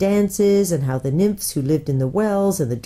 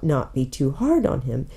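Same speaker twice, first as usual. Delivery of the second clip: ordinary delivery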